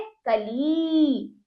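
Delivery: कली very slow, final ई stretched; drawn-out vowel. Shown as a demonstration. Teacher repeats the word for pupils, drawn out. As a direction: hold the ई in कली long. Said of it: "खींच कर करेंगे".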